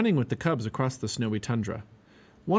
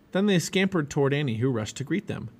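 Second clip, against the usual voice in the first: ordinary delivery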